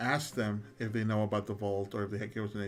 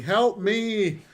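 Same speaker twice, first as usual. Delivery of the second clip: high, loud call for rescue; acted yell for help; shouted cry for help; 8 semitones higher, 8 dB louder than usual. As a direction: shout out like someone in trouble. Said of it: "Leo's shouting".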